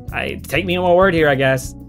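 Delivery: ordinary talk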